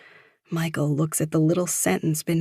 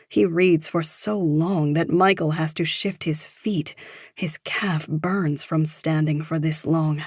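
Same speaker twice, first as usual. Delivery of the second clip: plain reading voice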